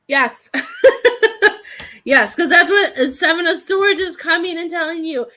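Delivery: thrilled as the card confirms it; giddy laugh then eager explanation; excited yes. Real, not acted.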